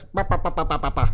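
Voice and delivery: mocking chattering bah; chipmunk babble. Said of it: "The chipmunks".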